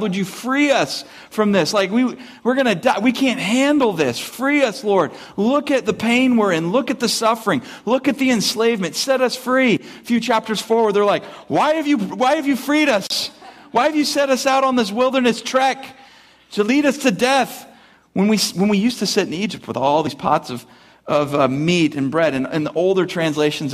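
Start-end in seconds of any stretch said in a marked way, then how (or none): 0.0-9.8 higher, pleading Israelites begging to be freed; mock desperate plea to the Lord; crying out to God. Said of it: "they were crying out to God". 11.5-22.3 higher, whiny grumbling at being led into the desert; mock whine of ungrateful wanderers; whining complaint. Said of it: "They grumbled, they complained, they whined".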